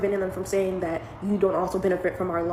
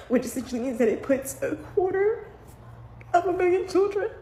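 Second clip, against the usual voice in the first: tearful; 10 semitones higher than usual. Real, not acted. Mom tells viewers about children in limbo, choking up. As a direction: voice rising and breaking on the numbers. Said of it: "I'm trying not to cry".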